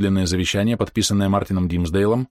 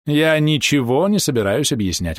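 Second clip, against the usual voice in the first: higher, imperious refusal; curt refusal; 6 semitones higher than usual. A narrator's reading. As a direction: shut the question down. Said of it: "отрезала Фрэнсис". (властно, отрезая)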